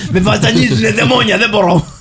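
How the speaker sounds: high-pitched, hammed-up tormented plea; mock possessed complainer. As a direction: play a patient begging the exorcist for relief. Acts possessed person pleading for exorcism.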